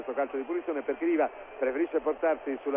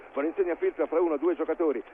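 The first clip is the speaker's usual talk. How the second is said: ordinary delivery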